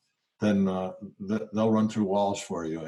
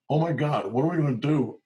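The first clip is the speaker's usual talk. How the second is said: higher, mock-panicked cry; terrified; 6 semitones higher than usual. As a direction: act out the boss's own fright. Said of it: "I got terrified".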